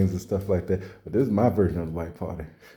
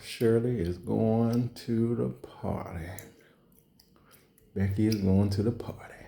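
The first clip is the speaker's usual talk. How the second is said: inner thought; 6 dB quieter than usual. Retold or imitated. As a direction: think it to yourself quietly. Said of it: "In your mind, you like".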